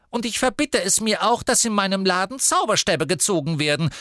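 high, hurried fussy witch; hastily voiced Madame Malkin; hasty shopkeeper. A narrator's reading. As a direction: rush it out, flustered and high. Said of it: "fügte sie hastig hinzu".

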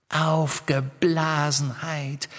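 mock him by echoing the word. A narrator's reading. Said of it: "wiederholte die Riddle-Hermine". (höhnisch gedehntes Echo der Riddle-Hermine)

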